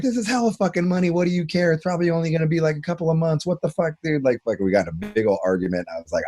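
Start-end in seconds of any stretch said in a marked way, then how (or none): 0.0-4.2 higher, exasperated mock-scold; wife scolding him over the money, hammed-up anger; pissed wife. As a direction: nag him like an annoyed wife. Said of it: "my fucking wife was super pissed".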